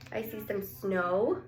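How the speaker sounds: ordinary delivery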